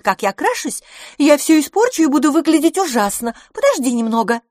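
high, loud, insistent exchange; busy, impatient Miss LaFosse. A narrator's reading. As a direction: fend off an intruder, high and loud. Voices quarrelling women in story. (нетерпеливо, высоко и громко)

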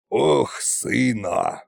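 sighing. A narrator's reading. Tsar father despairing of his son, sighing. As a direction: sigh out the words in disappointment. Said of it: "вздохнул царь-батюшка".

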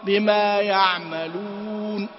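بما يعملون with the u of يعملون fully rounded; rounded lips. Shown as a demonstration. Tajweed teacher demonstrating lip rounding, slow recitation. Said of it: "لابد أن تنضم الشفتين".

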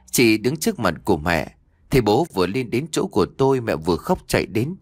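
ordinary delivery, nothing marked

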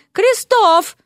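very high, indignant call; furious summons. A narrator's reading. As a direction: call the name out, pitched right up. Furious lady calls husband.

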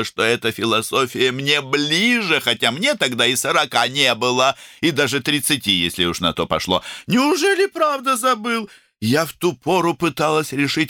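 high, emphatic, opinionated character voice; outspoken woman doctor. A narrator's reading. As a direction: hand down brisk opinions in a high voice.

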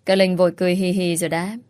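usual speaking voice

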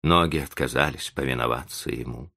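plain reading voice

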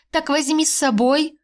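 hopeful lift in the voice. Acted. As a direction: ask with sudden hope. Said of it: "с надеждой посмотрела на него".

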